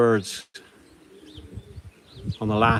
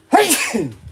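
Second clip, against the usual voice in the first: sudden loud sneeze; sneezing; 6 dB louder than usual. Real, not acted. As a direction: let out one sharp sneeze. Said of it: "I'm gonna sneeze".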